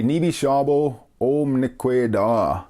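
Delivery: very slow, careful "Nibi shabo o mnikwe da"; slow demo. Shown as a demonstration. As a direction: lay each word out slowly for learners. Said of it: "You could say".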